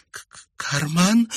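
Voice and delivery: high, frightened stutter; stuttered, scared question; stammering. A narrator's reading. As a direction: stumble on the first sound. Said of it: "заикаясь, проговорила Ариетта".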